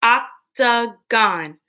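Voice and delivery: octagon slowly, in three separate beats; octagon split into syllables. Shown as a demonstration. Teacher breaking words into parts with pupils, octagon split in three. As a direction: space out each syllable of octagon. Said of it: "Break words into parts".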